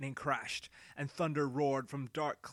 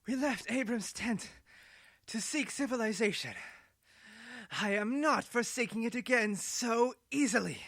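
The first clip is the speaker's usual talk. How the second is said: higher woman fading to a whisper; defiant Ado; 9 semitones higher, 6 dB louder than usual. Acted. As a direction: harden into a whispered vow. Ado's defiant speech.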